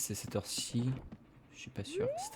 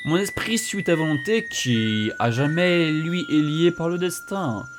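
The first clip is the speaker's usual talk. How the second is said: a hint of a solemn ghost, slow close; solemn spirit; 5 semitones higher, 6 dB louder, slower than usual. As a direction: give a grave parting charge. Spirit's farewell.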